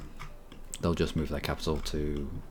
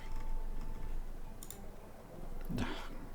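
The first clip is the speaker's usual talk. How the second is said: long weary sigh; 9 dB quieter than usual. Real, not acted. Frustrated with game screen.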